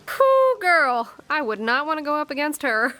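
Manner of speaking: loud high whoop, settling into chat; impressed aside. Real, not acted. Narrator breaks off to react.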